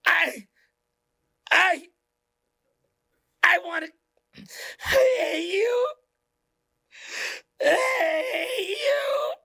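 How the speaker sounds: high, crying shriek of pure hate; possessed screaming hatred. Retold or imitated. Programmed person turning on you, shrieking and crying. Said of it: "I'm trying to do an accurate impression".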